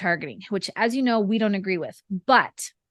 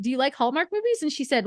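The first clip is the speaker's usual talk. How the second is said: ordinary talk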